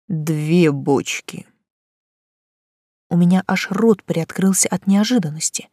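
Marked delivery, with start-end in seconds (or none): 0.1-1.5 slow, spiteful growl. A narrator's reading. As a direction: drag it out with malice. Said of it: "также злобно добавил".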